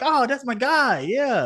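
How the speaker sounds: high-pitched, excited greeting of a buddy; delighted recognition. Retold or imitated. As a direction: imitate a thrilled client, pitch leaping up. Acting out client's delighted recognition.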